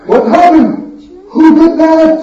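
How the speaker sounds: stunned at the smashed idols; shocked discovery. Acted. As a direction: townspeople horrified at broken idols.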